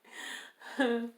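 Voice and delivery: high helpless giggling; can't stop giggling; giggle fit. Real, not acted. Starting to record, she breaks into helpless giggles. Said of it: "I just wanna have a giggle fest".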